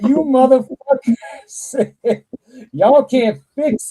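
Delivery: high and loud, laughing through the words; laughing outburst. Retold or imitated. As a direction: crack up while you accuse him. Reacting to the demon's speech.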